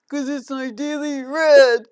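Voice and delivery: slow, loud put-on horse voice; horse punchline. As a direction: deliver the pun slowly as the cheeky horse.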